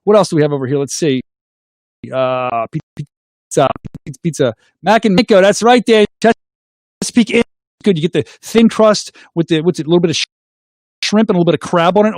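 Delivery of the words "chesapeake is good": ordinary talk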